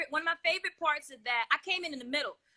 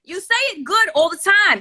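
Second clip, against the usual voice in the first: ordinary talk, nothing marked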